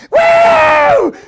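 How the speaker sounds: very high, loud, drawn-out whoop; kids' cheer. Retold or imitated. Kids cheering as they enter Oregon, loud whoop. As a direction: imitate all the kids whooping at once. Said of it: "All the kids are like".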